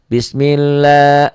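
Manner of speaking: "bismillah" recited with the "la" stretched out; long la. Shown as a demonstration. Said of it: "lam ini jadi panjang la".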